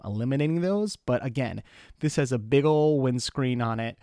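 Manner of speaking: usual speaking voice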